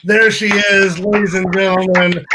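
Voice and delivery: big showbiz guest intro; announcer voice. Acted. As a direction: announce her like a game show host.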